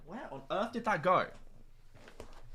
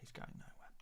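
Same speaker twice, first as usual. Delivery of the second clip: hushed whispered echo; 21 dB quieter than usual. Real, not acted. Streamer reassuring viewers about the player, in a whisper. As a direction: repeat it under your breath, barely audible. Hushed repeat of his own words.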